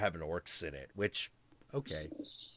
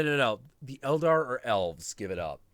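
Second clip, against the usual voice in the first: usual speaking voice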